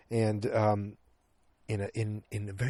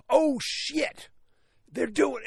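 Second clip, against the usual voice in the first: high-pitched reenacted movie-watcher excitement; excited reaction; 4 dB louder, much slower than usual. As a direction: exclaim it as if the big moment just hit. Retelling excited reaction to film.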